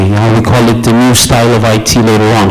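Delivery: ordinary delivery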